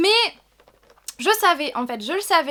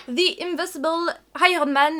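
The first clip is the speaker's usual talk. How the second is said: a light, hammed-up English accent on the name; mock English accent; 3 semitones higher, 4 dB louder than usual. Reading the tin's label in a mock English accent. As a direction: show off a fancy English accent, half-joking. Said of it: "avec mon accent super classe".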